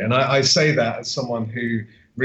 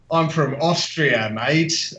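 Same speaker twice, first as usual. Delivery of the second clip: mock Australian accent; 4 semitones higher, slower than usual. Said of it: "It's just awful".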